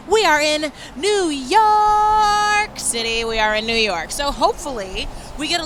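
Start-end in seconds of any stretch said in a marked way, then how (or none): 0.1-3.2 excited. Real, not acted. Host announcing the city excitedly.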